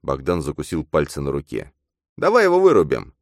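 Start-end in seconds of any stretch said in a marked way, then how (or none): 2.2-3.1 very high, loud suggestion; high-pitched sidekick shout; eager double. A narrator's reading. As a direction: urge it excitedly, pitched way up. Double urges knocking him out.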